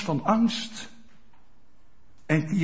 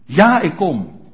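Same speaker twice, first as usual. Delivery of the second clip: voicing the answer; 9 dB louder, slower than usual. Congregant's answer voiced by preacher.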